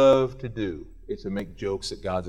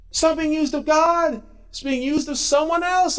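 raised high and loud; 11 semitones higher, 3 dB louder than usual. Shown as a demonstration. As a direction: lift it high and louder, punching NOT. Preacher repeats line, contrasting delivery.

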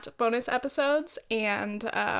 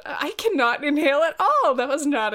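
loud, higher-pitched complaint; exasperated outburst; 4 semitones higher, 6 dB louder than usual. Real, not acted. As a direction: blurt it out after a failed breath. Failed deep breath, exclaims about it.

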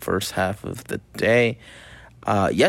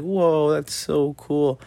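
high, slow impressed ooh; drawn-out mock wonder; talking to a kid; 6 semitones higher, much slower than usual. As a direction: play amazed for a little girl. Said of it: "And I was like".

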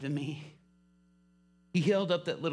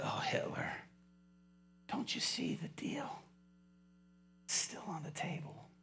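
hushed, near-whispered plea; gentle pleading; 8 dB quieter than usual. Retold or imitated. Preacher tenderly urging the prisoner.

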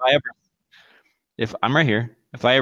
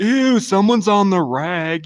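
mocking gossip; 7 semitones higher, slower than usual.

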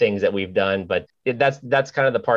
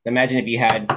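ordinary talk

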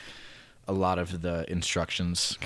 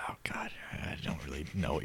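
whisper; 2 semitones lower, 8 dB quieter than usual. Retold or imitated. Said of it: "I'm like".

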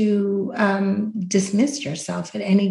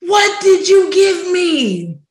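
higher, louder, slower demand; panicked yell; 9 semitones higher, 6 dB louder, slower than usual. Retold or imitated. She yells at the host in the bathroom, panicked and loud. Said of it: "I go".

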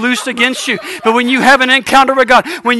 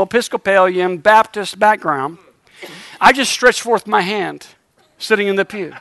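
ordinary talk, nothing marked

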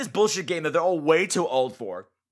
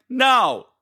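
a loud, flat refusal; stubborn hero no; 7 semitones higher, 6 dB louder than usual. Retold or imitated. Mocking the hero refusing to leave, loud refusal. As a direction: shut her down heroically. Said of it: "He's like".

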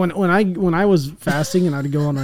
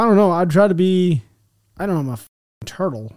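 ordinary delivery

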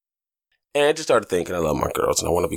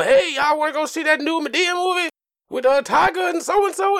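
very high, hammed-up mock friendliness; overeager parent act. Dad inviting his daughters to a movie, mock eager. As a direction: play a parent trying too hard to be cool. Acts a parent trying to be cool.

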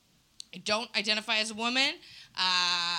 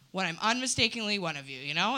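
ordinary delivery

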